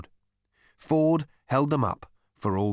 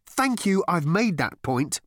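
ordinary delivery

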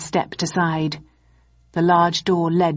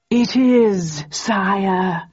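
grand, hammed-up Shakespearean reply; hammy stage actor; 3 semitones higher, much slower than usual. A narrator's reading. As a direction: declaim it like an old-school thespian. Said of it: "He is an actor of some distinction".

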